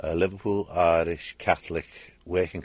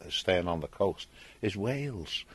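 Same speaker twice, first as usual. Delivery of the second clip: ordinary talk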